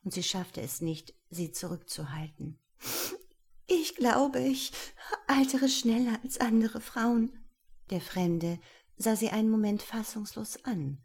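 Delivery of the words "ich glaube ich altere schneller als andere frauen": high, near tears, strained; tearful. A narrator's reading. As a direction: hold back tears while confessing. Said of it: "wie ihr die Tränen in die Augen stiegen". (den Tränen nahe)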